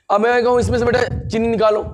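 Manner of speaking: ordinary talk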